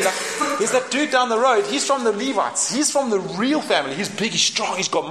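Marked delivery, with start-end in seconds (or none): none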